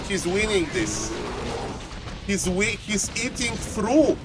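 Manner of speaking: very soft, stunned commentary; hushed disbelief. Real, not acted. Caster watching Hawk winning this, hushed disbelief. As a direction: drop the voice right down, stunned.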